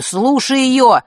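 mild narrated outburst; urgent boy's plea. A narrator's reading. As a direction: burst out unable to hold back. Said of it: "не выдержал Ник".

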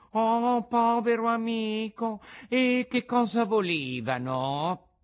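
high, loud, effusive fox pity; commiserating fox. A narrator's reading. Fox pities puppet over assassins, exaggerated sympathy.

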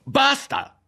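a piercing, loud Basta!; shouting. A narrator's reading. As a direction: let the Basta! burst out highest. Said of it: "fu sul punto di gridare".

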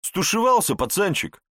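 high, loud mocking taunt; taunting thug. A narrator's reading. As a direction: jeer at the young guy like a bully. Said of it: "смеялся Верзила".

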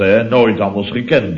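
ordinary talk, nothing marked

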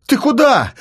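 high, alarmed yell. Acted. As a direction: yell after someone walking off. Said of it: "завопил Рома".

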